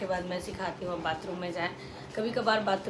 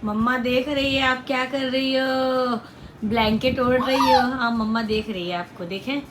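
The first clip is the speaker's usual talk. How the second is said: louder, higher playful mother voice; 3 semitones higher, 9 dB louder than usual. Real, not acted. Mother talking to toddler.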